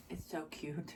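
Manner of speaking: soft breathy whisper of delight. Real, not acted. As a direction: whisper it, charmed by something cute. Cooing over cute craft item.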